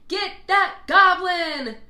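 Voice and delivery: loud, slow, high group shout. Acted. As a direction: bellow it as a mob. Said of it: "shouted everyone".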